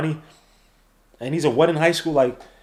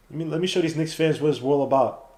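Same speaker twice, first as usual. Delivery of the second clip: ordinary talk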